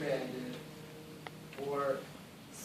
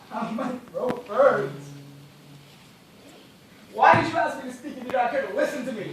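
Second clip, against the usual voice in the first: rising to a hoarse, laughing shout, higher and louder; bitter mad laugh, louder; bitter outburst; 6 semitones higher, 7 dB louder than usual. Acted. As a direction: lash back, half mad. Parched man snaps back bitterly.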